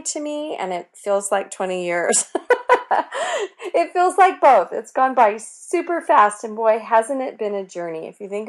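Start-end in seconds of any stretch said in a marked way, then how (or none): 2.3-3.7 a burst of high laughter; bright high laugh. Real, not acted. Laughs at twenty years. 3.8-6.4 laughing. Real, not acted. Laughing excitement about anniversary.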